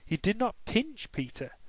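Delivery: usual speaking voice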